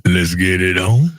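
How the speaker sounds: slow, deep crooner. Pretend. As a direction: croon it slow like a soul singer.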